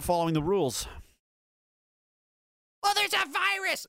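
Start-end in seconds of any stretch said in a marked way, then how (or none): 2.8-3.9 high whiny mock voice. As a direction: sneer it in a high mocking tone. Mocking the other side's excuse.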